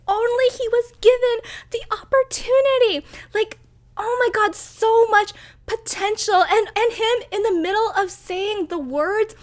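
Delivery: high, loud, overwhelmed gush; can barely contain the feelings; worked up. Real, not acted. Reviewer reliving the king's death, high and worked up. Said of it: "I'm getting way too worked up".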